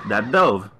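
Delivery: DOVE with a long O, rhyming with stove; slow, insistent long O in dove; mispronunciation. Real, not acted. Standing by a misreading, dove said like stove. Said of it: "pronounce dove as dove".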